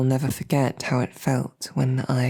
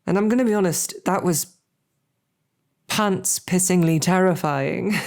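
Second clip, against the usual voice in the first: excited confession; 4 semitones higher than usual. Acted. Guard admitting to his listeners how terrified he was, excited. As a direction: blurt out how scared you were. Confessing terror of the dragon attack.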